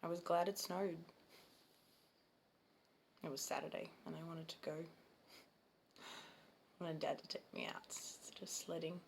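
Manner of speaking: put-on subdued childhood recollection with long pauses; understated, soft dramatic monologue; Ken in monologue. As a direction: recall it haltingly, leaving silence between phrases. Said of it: "the part I'm playing is Ken".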